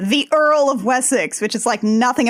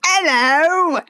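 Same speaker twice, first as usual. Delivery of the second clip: Cockney hello; 5 semitones higher than usual. Retold or imitated. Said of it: "dropping all of their H's".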